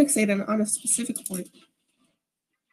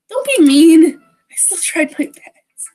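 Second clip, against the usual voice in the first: higher, loud start on a whiny complaint; playful protest; 4 semitones higher, 6 dB louder, slower than usual. Acted. Girl defends her poem from teasing, pouty protest. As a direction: protest with a pout, then ease off.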